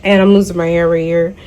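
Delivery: ordinary delivery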